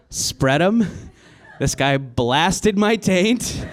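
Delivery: ordinary delivery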